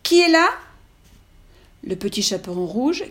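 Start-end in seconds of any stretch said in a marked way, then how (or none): 0.0-0.5 gruff wolf voice. Acted. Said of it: "la grosse voix du loup".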